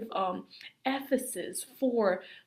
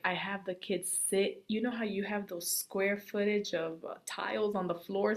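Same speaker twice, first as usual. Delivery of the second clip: usual speaking voice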